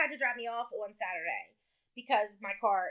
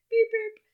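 high, loud beep-beep; imitated car horn; 10 semitones higher, 5 dB louder than usual. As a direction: toot it like a horn.

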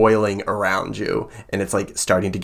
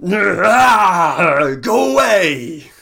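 high, loud, drawn-out creature shout; the centipede screams at the girl to leave; monster screech; 9 semitones higher, 6 dB louder, much slower than usual. Acted. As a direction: shriek like a giant centipede.

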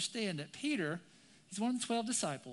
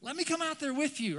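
high, raised, eager plea; adrenaline-high eagerness; 8 semitones higher, 6 dB louder than usual. Acted. Peter asks Jesus to let him come out, excited. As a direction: call out keenly, carried away. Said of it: "he's on the high of adrenaline".

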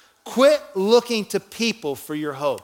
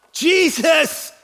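high, drawn-out cry to Jesus; shouted plea; 8 semitones higher, 4 dB louder than usual. Acted. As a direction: call out as if from a distance. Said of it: "They shouted".

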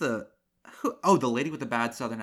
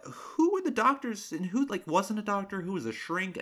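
confused high question; 9 semitones higher than usual. Real, not acted. Confused rant about characters.

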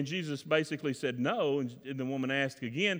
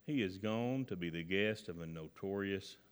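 low, very soft disapproving grumble; a crowd muttering its disapproval, low and soft; grumbling; 7 semitones lower, 9 dB quieter than usual. A narrator's reading. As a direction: grumble it under your breath. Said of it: "they grumbled".

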